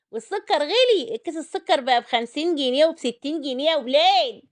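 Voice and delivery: high, loud complaint about prices; indignant outcry. Pretend. Cook complains to viewers about sugar prices, indignant outcry.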